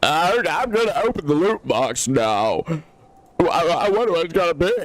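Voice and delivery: hammed-up mock YouTuber voice; Markiplier. Streamer mocking a YouTuber opening a loot box, hammed-up impression. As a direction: put on an obnoxious showman's voice. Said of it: "This is my Markiplier impression".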